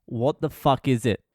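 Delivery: normal talking voice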